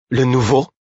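high-pitched, indignant outburst; furious exclamation. A narrator's reading. Servant girl recognising her friend, furious. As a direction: exclaim it in outrage, voice jumping up. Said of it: "s'exclama-t-elle avec fureur".

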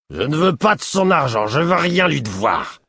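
high, loud, slowing proud refusal; bitter refusal. A narrator's reading. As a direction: spit out the refusal. Proud drunk refusing money. (refus amer et fier)

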